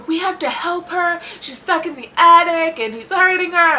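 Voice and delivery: high-pitched mock-urgent plea; urgent pleading. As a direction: mimic worked-up friends begging to help. Acting out friends pleading for the ghost.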